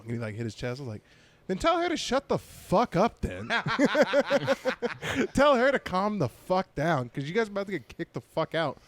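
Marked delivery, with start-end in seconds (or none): 1.5-3.5 high-pitched mock outrage; exasperated retort. Comic telling boyfriend to silence her, exasperated. 5.3-8.8 higher-pitched mock scolding; exasperated warning. Comic warning boyfriend they'll be kicked out, exasperated.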